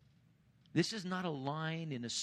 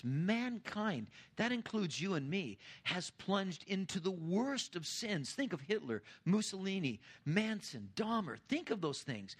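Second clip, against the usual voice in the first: full-force preaching; 5 semitones higher than usual. Acted. Pastor to congregation about mankind's sin, preaching at full force. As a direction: preach it out, hammering each phrase to the room.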